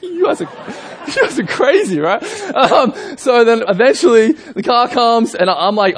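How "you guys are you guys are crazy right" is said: high, laughing exclaim; laughing disbelief. Retold or imitated. Groom to the useless older man, high laughing disbelief.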